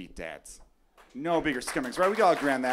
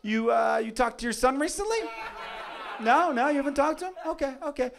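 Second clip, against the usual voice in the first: higher, teasing taunt; a coworker ribbing, voice pitched up; mocking coworker; 7 semitones higher than usual. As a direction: needle the man at lunch. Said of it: "Just like".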